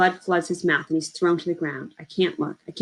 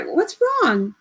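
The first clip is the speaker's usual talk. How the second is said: much higher, anxious cry; voice pitching high; 12 semitones higher than usual. Acted. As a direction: let the voice jump up in panic. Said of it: "his voice pitches high".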